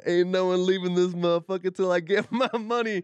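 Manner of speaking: high, shouted mock-gangster threat breaking into laughter; movie gangster. Demanding his stolen money from friends, hammed-up gangster shout. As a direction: bellow it like a hammed-up gangster, cracking up. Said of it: "I've seen some gangster movies".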